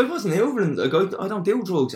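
high-pitched retold denial; nervous protest. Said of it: "'cause I'm getting real nervous".

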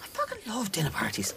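hushed confession; breathless whispered admission. Real, not acted. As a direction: whisper it like a guilty secret. Confessing a love of dinner parties.

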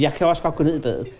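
understated resignation, dropping in pitch; slightly resigned. Retold or imitated. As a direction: imitate a mildly defeated offer. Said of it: "det er også lidt opgivende".

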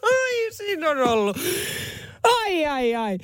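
words through high laughter; laughing remark, pitched high. Real, not acted. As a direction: talk while still laughing. Host still laughing after story.

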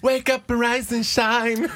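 high, slow sing-song wake-up; wake-up call. Acted. Morning show host waking listeners with a high sing-song.

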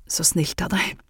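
a hint of hoarseness after coughing; hoarse. A narrator's reading. Miss Øyseth thanks Anne after a cough, lightly hoarse. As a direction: say it a little rough, throat sore from coughing. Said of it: "sa hun hest".

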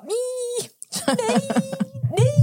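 very high, tiny embarrassed squeak; squirming squeal. Retold or imitated. Asked if she is a celebrity, hammed-up cringing squeal.

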